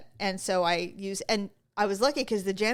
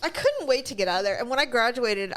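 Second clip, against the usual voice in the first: usual speaking voice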